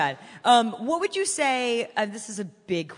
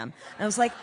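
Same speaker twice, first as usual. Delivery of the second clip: ordinary talk, nothing marked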